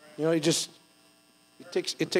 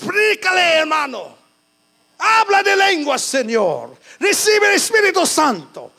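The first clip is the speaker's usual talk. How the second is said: much higher, loud calls of a prayer warrior; fervent Spanish prayer; 11 semitones higher, 6 dB louder than usual. Retold or imitated. Pastor recalling how he prayed in Spanish church, calling out high and loud. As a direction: cry out the Spanish phrases like a fired-up church member.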